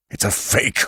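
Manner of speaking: a hoarse, voiceless rasp; a stunned hoarse croak, barely voiced. A narrator's reading. Fat man to Spade, discovering the lead, hoarse whisper. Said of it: "he said hoarsely".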